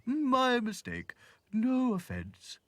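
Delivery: very slow, high, loud drawl; apologising giraffe. Acted. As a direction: stretch out the apology.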